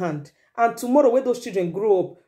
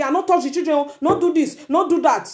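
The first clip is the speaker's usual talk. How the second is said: pitched-up mock protest; higher, insistent acted scolding; imitating a protective father; 6 semitones higher than usual. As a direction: object like a dad defending the children. Mimics man protecting children.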